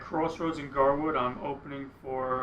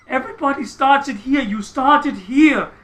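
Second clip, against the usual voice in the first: loud, high mock lecturing; imitated scolding; 9 semitones higher, 10 dB louder than usual. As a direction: correct the player loudly, dragging it out at the end. Imitating hero correcting his playing.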